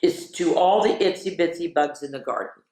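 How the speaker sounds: ordinary delivery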